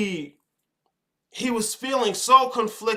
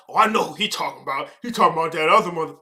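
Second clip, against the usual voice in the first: mock gossip; 3 semitones lower, faster than usual. Mocking gossiping listener.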